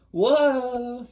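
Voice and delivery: high, loud stunned whoa; taken aback, blurt it out. Real, not acted. Hearing a stranger's secrets, stunned whoa.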